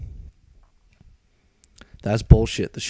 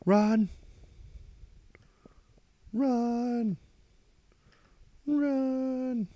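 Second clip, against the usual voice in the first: mock shout that climbs and trails away; imitating yelling; much slower than usual. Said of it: "He's like".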